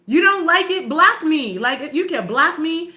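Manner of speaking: loud, high, defiant challenge; defiant outburst. Real, not acted. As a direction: throw it at the haters, loud and high. Challenging hostile commenters.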